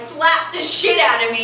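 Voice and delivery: ordinary talk, nothing marked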